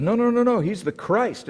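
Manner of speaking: high, eager insisting crowd voice; a crowd member insisting, high and eager; excited insistence. Retold or imitated. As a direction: jump in to correct them eagerly.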